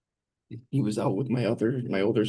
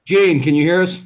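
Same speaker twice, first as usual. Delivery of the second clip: raised voice calling across the room; almost yelling; 7 semitones higher, 10 dB louder than usual. Real, not acted. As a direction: call out loud so she hears. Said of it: "that was almost yelling".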